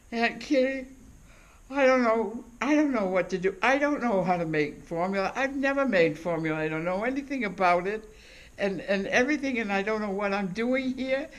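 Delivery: high, fast, panicky new mother; mock the tearful niece who can't cope; imitating upset niece. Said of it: "and she was crying".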